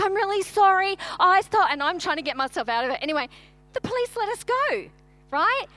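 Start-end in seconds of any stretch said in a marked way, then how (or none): 0.0-1.7 pleading apology. Retold or imitated. Trying to get out of fine.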